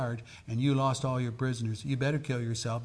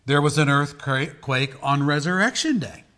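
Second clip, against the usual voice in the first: ordinary talk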